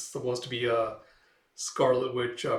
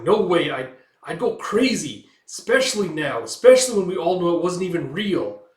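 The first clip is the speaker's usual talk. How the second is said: higher, much louder, mock panicked; frantic refusal; 6 semitones higher, 10 dB louder than usual. Gail refusing to go back, shouting. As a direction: refuse it, near yelling.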